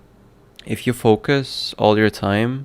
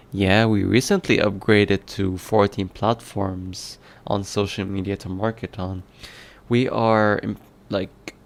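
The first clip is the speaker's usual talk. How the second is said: ordinary delivery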